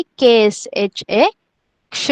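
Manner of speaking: slowly name के, S, H, ए, then क्ष; spelled-out pronunciation. Shown as a demonstration. Teacher showing pupils how to write क्ष, spelled out slowly. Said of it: "तो क्या लिखेंगे".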